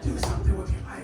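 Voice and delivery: a hushed voice in your head; whispered nag. Acted. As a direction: whisper it like a nagging inner voice. Inner voice nagging.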